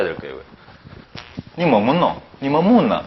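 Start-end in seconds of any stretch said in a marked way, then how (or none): 1.6-2.2 quick Gyeongsang "니 뭐 묻노" with falling pitch; natural Gyeongsang dialect question; Gyeongsang accent. Shown as a demonstration. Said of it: "다르죠?". 2.4-3.0 Gyeongsang "니 뭐 묻나", pitch rising to a peak then dropping; brisk Gyeongsang dialect question; Gyeongsang accent. Shown as a demonstration. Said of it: "다르죠?".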